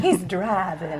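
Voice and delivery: mimicking a Southern Virginia lady, stretched vowels; playful copy of a Southern Virginia accent; imitated Southern Virginia accent. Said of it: "Oh, that's a nice accent".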